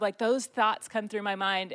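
ordinary delivery

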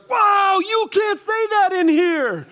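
high, slow, shouted mock outrage. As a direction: bellow it slowly, way up high like a shocked inmate. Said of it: "this was the response I got".

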